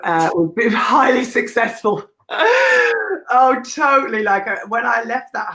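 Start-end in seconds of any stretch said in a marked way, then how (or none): 2.3-3.2 sudden high burst of laughter; a big laugh that breaks up high. Real, not acted. Big laugh after joke.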